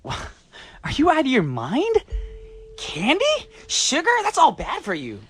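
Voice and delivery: high incredulous outburst; stunned, can't believe the candy; shocked disbelief. Acted.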